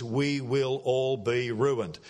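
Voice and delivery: slow translation, each word separate; slow proper English. Shown as a demonstration. Preacher translating slang for overseas listeners, slow proper English. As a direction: give the full words slowly and plainly. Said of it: "That means".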